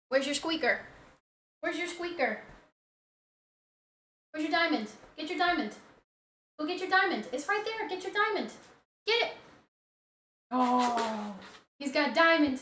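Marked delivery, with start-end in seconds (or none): 0.1-9.3 high, light coaxing voice; high-pitched pet talk; playful dog talk. Real, not acted. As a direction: coax a pet to fetch in a high voice. Urging dog to fetch toy.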